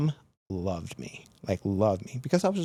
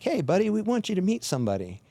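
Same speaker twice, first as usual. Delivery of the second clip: higher, sing-song fake cheer; mock friendly cop; 9 semitones higher, faster than usual. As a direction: greet him with a sly grin. Cops teasing with mock friendliness.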